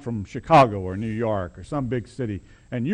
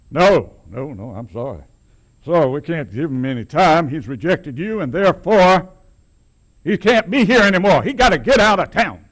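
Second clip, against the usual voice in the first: louder, higher judge laying down the sentence; stern judge's verdict; 5 semitones higher, 5 dB louder than usual. Retold or imitated. Preacher voicing the judge ordering the man out of town. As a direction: banish him from town without appeal.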